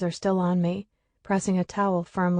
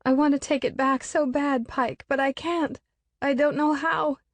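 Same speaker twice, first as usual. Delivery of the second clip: tearful wailing; 7 semitones higher than usual. A narrator's reading. Continues wailing to nobody.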